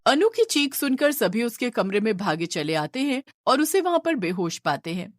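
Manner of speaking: ordinary delivery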